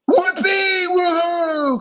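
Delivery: very high, slow whooping cry; joyful cheer. A narrator's reading. Zoo animals cheering together, very high. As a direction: shout for joy. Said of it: "cried the animals of the zoo".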